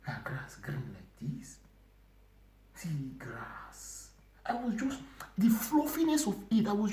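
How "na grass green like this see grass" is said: breathless whisper of wonder; awed whisper. Retold or imitated. Marveling at the green grass in the dream, whispered. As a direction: hush it, amazed at the grass. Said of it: "I say".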